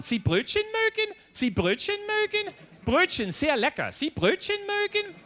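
high, deliberate singsong foreigner talk; mock condescending old lady, voice pitched high; old woman talking down to foreigner. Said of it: "Leute sprechen sie nicht nur laut an".

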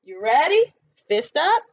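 ordinary talk, nothing marked

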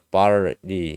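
slow, syllable-split "barley" with R; R kept in. Shown as a demonstration. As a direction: spell out "barley" slowly, bar then lee. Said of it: "R이 그냥 그대로 들어간다고". ("barley"를 R 살려서 천천히)

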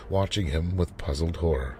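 ordinary delivery, nothing marked

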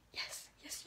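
hushed urgent whisper; whispered plea. Retold or imitated. Reader whispering to the characters that they know each other. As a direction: breathe it at the page.

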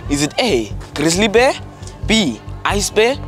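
ordinary talk, nothing marked

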